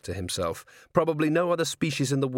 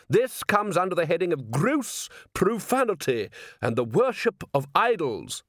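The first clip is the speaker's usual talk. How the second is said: angry priest; 7 semitones higher than usual. A narrator's reading. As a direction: scold like an outraged priest.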